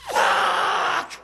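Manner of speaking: high, breathy shouted swear; frustrated curse. Retold or imitated. Tourist cursing when the cab refuses. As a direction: spit out the curse in defeat.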